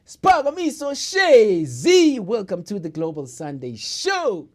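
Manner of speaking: booming slow show intro; big radio host opening; announcer voice. Real, not acted.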